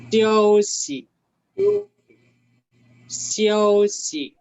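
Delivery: XIAOXI with XIAO loud, high and held, XI short; first toned, second toneless. Shown as a demonstration. Teacher contrasting the syllables, XIAOXI strong then toneless. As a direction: push XIAO high and level, drop XI lightly. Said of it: "La primera tiene tono y la segunda no".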